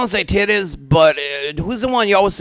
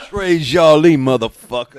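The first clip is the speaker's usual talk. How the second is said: mock French accent; 6 dB louder than usual. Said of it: "French Jackson".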